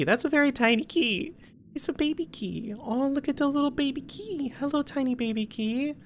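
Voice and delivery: put-on high cooing baby-talk voice. Gamer cooing to a tiny key in high baby talk. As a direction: coo at it like a tiny pet.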